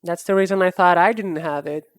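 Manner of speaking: usual speaking voice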